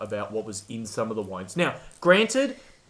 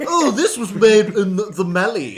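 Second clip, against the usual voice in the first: louder, higher mock snob; mocking a wine snob, louder; pretentious expert; 7 semitones higher, 9 dB louder than usual. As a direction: ham up a pompous sommelier. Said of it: "crap and bogus things to go".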